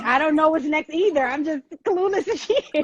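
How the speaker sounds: high, slowing, bewildered aside; retold cluelessness. Sitting in the seat beside excited friend, clueless.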